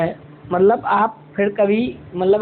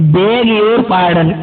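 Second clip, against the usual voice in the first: very slow and a bit loud, every word drawn out; slow, loud teaching demo; slow model phrase; 4 dB louder, much slower than usual. Shown as a demonstration. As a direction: say it slowly so students copy. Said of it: "क्या बोलेंगे".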